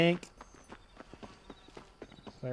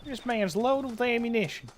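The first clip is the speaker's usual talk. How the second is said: put-on cowboy accent; 8 semitones higher than usual. Streamer remarking on looted ammo, cowboy accent. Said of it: "It's the cowboy accent".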